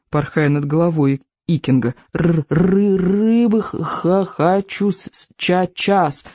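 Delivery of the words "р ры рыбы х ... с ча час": slow, stuttering little dragon voice; stammering baby dragon. A narrator's reading. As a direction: drag out each broken syllable, begging for fish. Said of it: "пищал Беззубик". (заикающийся дракончик)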